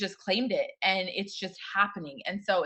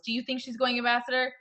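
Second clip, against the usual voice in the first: usual speaking voice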